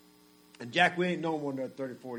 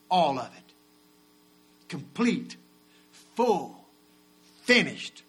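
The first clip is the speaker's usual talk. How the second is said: preaching at full force; 3 semitones higher, 6 dB louder than usual. Acted. Preacher crying out Christ's last words at full force. Question and answer climax.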